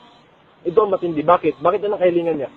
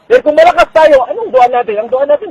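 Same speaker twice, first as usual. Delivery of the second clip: preaching shout; 7 semitones higher, 6 dB louder than usual. Real, not acted. Preacher builds to a peak.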